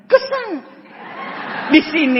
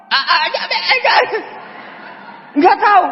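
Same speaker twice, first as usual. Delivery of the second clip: laughing stutter, voice shooting up at the end; mock stammering; 10 semitones higher, slower than usual. Cornered student stammering when asked for proof, laughing mock stutter. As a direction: stall with stuttered a-s, then a high squeak.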